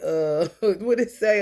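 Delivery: loud laughing, slowed down. Real, not acted. Reading tarot cards aloud to viewers, laughing loudly. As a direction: laugh through it, puzzled.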